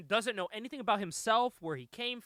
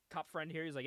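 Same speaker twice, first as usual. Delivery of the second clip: ordinary delivery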